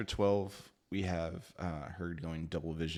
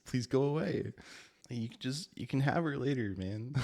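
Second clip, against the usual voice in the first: higher-pitched, half-joking plea; mock pleading; 6 semitones higher, 4 dB louder than usual. Reader begging the Shadow Beast to leave, mock pleading. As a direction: beg playfully, voice lifted.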